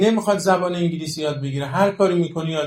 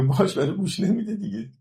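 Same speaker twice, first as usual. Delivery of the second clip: higher-pitched exasperated father, ولی گوش نمیده دیگه; fed-up father; 6 semitones higher than usual. Retold or imitated. Father telling the teacher his child won't listen, exasperated. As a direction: complain like a weary father about a child who won't listen.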